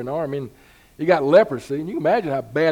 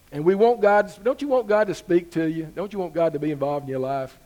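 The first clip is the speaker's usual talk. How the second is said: ordinary delivery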